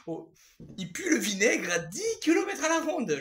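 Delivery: high, loud and half laughing; laughing disgust. Real, not acted. Vlogger reacting to their stinking T-shirt, laughing in disgust.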